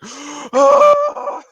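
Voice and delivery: sudden high yelp; mock adrenaline yelp. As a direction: yelp as if jolted. Mimics adrenaline rush.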